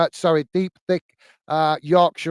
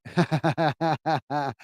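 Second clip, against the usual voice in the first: long run of chuckles; rapid amused giggling; teasing laugh; 4 dB quieter than usual. Real, not acted. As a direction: laugh at your own cheeky tease. Laughs at own tea joke.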